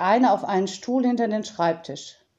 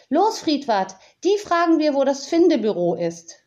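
eager urging; 6 semitones higher, 4 dB louder than usual. Acted. Tiziana urges raven eagerly.

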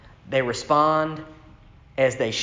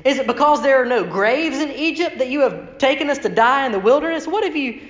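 higher, complaining Israelite whine; whining Israelites; 8 semitones higher than usual. Retold or imitated. The grumbling people complain to Moses in a higher whine. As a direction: whine at Moses like you're doomed. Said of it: "they said to Moses".

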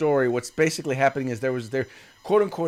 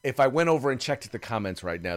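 ordinary delivery

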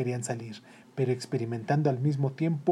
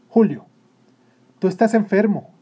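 loud, raised note of alarm; alarmed motherly concern; worried mother; 7 semitones higher, 10 dB louder than usual. Acted. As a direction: call out your concern to your son. Worried mother addresses her son.

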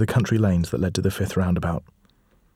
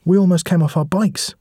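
higher, louder indignant complaint; angry; 10 semitones higher, 4 dB louder than usual. Acted. Edie scolding the hooded figure, higher and angry. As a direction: snap at the stranger in the road. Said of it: "said Edie angrily".

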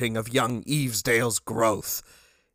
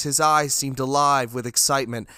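ordinary delivery

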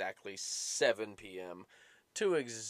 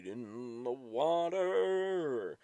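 drawn out; 4 semitones higher, 4 dB louder, much slower than usual. Acted.

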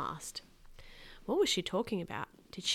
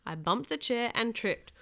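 plain reading voice

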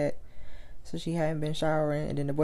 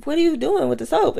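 high, baffled question; a hint of a bewildered guy; puzzled man, voiced high; 9 semitones higher, 5 dB louder than usual. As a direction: ask it high and confused. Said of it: "he was like".

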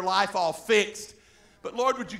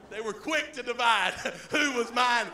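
normal talking voice

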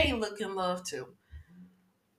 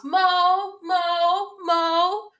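high sing-song call across the house; 10 semitones higher, 5 dB louder, much slower than usual. Retold or imitated. Kyle calling for Mauricio.

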